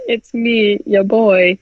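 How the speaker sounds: lightly voiced bro entity, casual; a hint of a dudebro demon; mock entity bro. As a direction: play the demon like a chummy bro.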